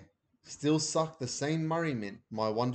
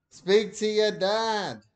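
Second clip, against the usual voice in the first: Mum on the phone; 9 semitones higher, 7 dB louder, slower than usual. A narrator's reading.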